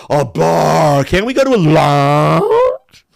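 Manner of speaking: mocking a Florida girl who wants a lounge; Florida girl twang. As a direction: stretch it out like a spoiled Florida girl. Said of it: "That's the way they talk".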